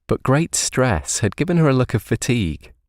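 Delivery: usual speaking voice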